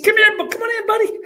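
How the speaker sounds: high-pitched coach voice inviting; Coach Spurrier impression. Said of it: "That's how he talks".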